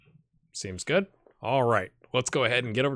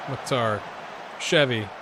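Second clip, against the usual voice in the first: usual speaking voice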